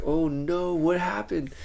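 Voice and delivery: re-enacted alarmed outburst, not shouted; retold panic. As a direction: act out a mild freak-out. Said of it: "I went to flip mode".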